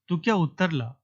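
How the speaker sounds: plain reading voice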